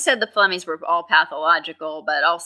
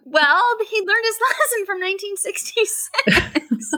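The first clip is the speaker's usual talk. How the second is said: laughing; 13 semitones higher than usual. Real, not acted. Co-host laughing at the accent.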